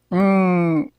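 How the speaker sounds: ordinary talk, nothing marked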